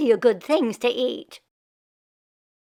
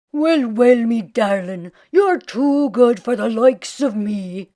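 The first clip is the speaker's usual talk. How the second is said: lazy Irishman; 6 dB louder than usual. Acted. Jamie flattering his wife.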